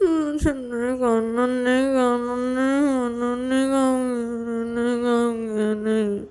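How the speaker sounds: long, droning high whine round food; mouth full refusal. Acted. Payal refuses with food held in her mouth, muffled drone. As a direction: refuse slowly through a held mouthful, words run together. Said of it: "वो मुंह के अंदर रखी हुई थी".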